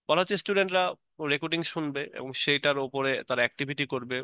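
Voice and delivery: ordinary talk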